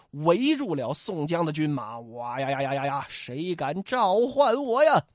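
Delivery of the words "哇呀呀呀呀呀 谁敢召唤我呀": summoned giant. Acted. Voices summoned armoured giant.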